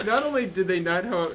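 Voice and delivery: ordinary delivery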